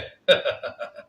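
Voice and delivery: airy scornful chuckle; husband sneering at his wife; breathy mocking laugh. A narrator's reading. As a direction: laugh at her pain. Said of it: "اس نے ہنستے ہوئے".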